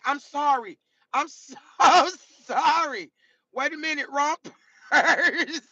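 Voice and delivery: high-pitched, laughter creeping in; flustered apology. Real, not acted. Streamer apologising to Casey for a slip, flustered.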